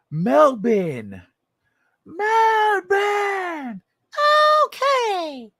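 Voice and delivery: high squeaky chipmunk calling Alvin; high cartoon chipmunk voice. Shown as a demonstration. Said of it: "It'll make more sense like this".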